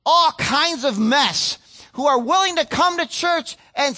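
slow, swelling sermon delivery; fervent pulpit voice; impassioned preaching. Acted. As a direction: stretch each phrase out to the congregation. Preaching at full force.

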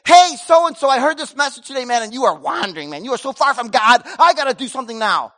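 loud, high, pushy lecture; mock overzealous confronter. Pastor mocking a heavy-handed churchgoer, loud and pushy. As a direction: play the preachy busybody. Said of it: "go get the wanderer and say".

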